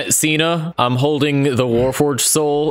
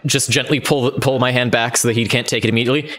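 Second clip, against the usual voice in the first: ordinary talk, nothing marked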